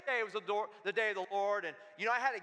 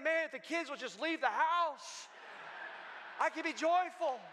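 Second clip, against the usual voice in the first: mock weary parent; 5 semitones higher than usual. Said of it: "And then we start thinking".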